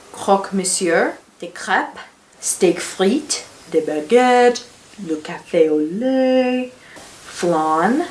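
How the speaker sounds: relishing French list. Real, not acted. Student listing super French meals, relishing each one. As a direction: savour each French dish with mounting emphasis.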